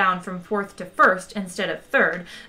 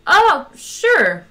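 breezy acknowledgement; 10 semitones higher, 8 dB louder than usual. A narrator's reading. Zaphod's cool reply to introduction.

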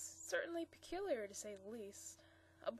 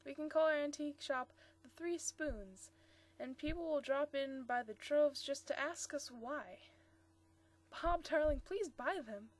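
louder, higher eager plea; 4 semitones higher, 6 dB louder than usual. Acted. Marcia eagerly begging Bob to buy the spoons. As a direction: coax him to buy them. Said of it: "she added eagerly".

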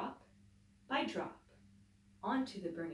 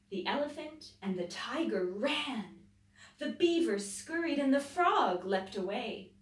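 story narration; 3 dB louder than usual. A narrator's reading. Teacher reading a picture book to children, dramatic. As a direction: read the fire scene with building drama.

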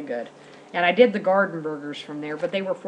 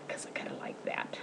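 confiding whisper; soft guilty-pleasure whisper; whispered aside; 14 dB quieter than usual. Real, not acted. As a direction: whisper it like a little secret. Aside about wanting more.

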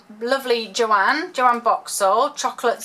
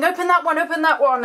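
higher, louder mock-eager chant of viewers; pushy viewers; 8 semitones higher, 5 dB louder, faster than usual. Viewers begging her to open that one, higher, louder mock chant. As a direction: play the impatient audience urging her on. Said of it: "I'm sure you're all looking at one saying".

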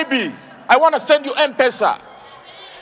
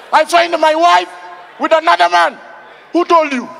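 high, loud mock indignation; hammed-up outrage; 5 semitones higher, 5 dB louder than usual. Auditioner playing husband who found wife cheating, loud mock outrage. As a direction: cry out the badly acted shock in chunks. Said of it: "Imagine you phoned your wife".

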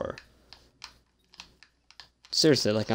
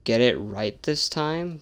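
ordinary delivery

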